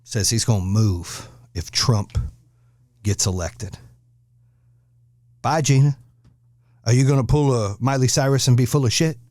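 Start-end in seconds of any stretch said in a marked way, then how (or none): none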